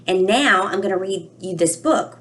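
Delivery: usual speaking voice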